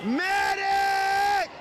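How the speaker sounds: man down shout. Real, not acted. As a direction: scream for a medic across the crowd. Calling for medic at protest.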